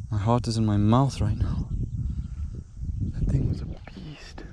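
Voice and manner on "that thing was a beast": slow, hushed amazement; soft, stretched-out awe; awed whisper. Real, not acted. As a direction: whisper it slowly in disbelief. Awed after losing a huge fish.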